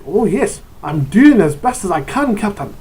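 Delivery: a hint of a flustered Scotsman; lightly voiced Scottish ship engineer; Scotty impression. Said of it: "if you have a Mister Scott in your group".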